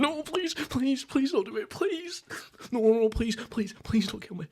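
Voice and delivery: high, panicked pleading for life; terrified begging. Retold or imitated. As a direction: beg hysterically, voice shooting way up. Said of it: "and I'm shaking and crying".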